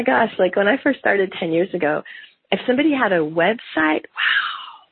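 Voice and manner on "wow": ordinary talk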